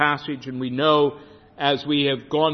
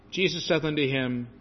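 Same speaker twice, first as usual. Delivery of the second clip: ordinary delivery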